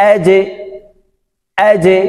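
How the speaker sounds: "আজে" with the জ bounced downward; downward qalqala. Shown as a demonstration. Teacher repeating "আজে" for practice, downward qalqala. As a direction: release "আজে" on a short echoed e. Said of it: "নিচের দিকে ধাবিত হবে".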